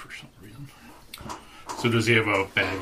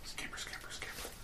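whispered scamper; 19 dB quieter than usual. Pretend. Sneaking while invisible.

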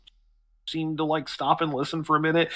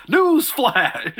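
excited shout; 12 semitones higher, 4 dB louder than usual. Acted. Excited bard announcing himself.

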